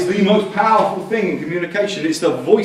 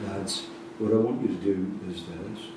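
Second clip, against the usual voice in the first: a coach going low and calm instead of shouting; quiet calm coach; 9 semitones lower, 11 dB quieter than usual. Retold or imitated. Coach at half time hushing a noisy dressing room, quietly. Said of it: "went down to his level".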